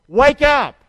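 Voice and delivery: the preacher calling for a loud repeat. Shown as a demonstration. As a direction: belt it out for the church to repeat. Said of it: "Say it with me".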